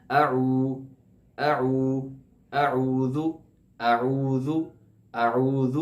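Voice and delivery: اعوذ with ذ from tongue tip at teeth; touch tongue tip to teeth for ذ; careful اعوذ. Shown as a demonstration. Teacher drilling students on اعوذ. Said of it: "زبان کی نوک کو لگا دینا ہے".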